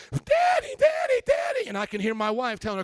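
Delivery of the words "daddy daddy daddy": put-on very high, loud child's call; imitating daughter yelling at his bedroom door; child shouting daddy. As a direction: bang on the door and yell for daddy. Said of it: "banging on the door".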